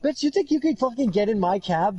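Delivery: mock outrage, pitched high; high-pitched retold indignation; indignant outburst. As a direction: snap at an intruder, playfully hammed up. Acting out his game reaction.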